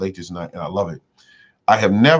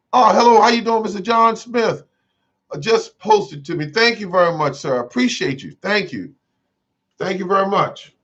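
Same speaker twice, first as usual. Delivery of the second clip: overly gushing thanks; 10 semitones higher than usual. Pretend. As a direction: play a streamer fawning over a donor. Acting out streamer thanking donor.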